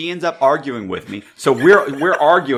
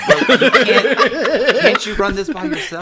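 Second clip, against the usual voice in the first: high-pitched, exasperated laugh-talk; real laughter breaking through the words; laughing protest; 12 semitones higher than usual. Real, not acted. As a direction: plead with a buddy while cracking up. Laughing plea to the co-host.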